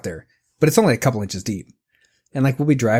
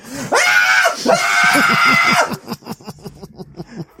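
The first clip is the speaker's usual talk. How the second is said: piercing high shriek reenacting a terrified kid; shrieking terror; mock child scream; 4 dB louder than usual. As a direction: scream wildly as a panicked little girl. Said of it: "reenact what happens when she sees a gnat".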